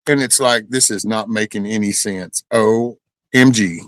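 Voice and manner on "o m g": normal talking voice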